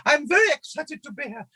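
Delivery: very high, squeaky excitable character voice; high puppet voice, bubbling over; Otis. Shown as a demonstration. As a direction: squeal it with cartoonish glee, far above your natural register. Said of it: "his voice was like this".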